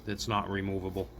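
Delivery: usual speaking voice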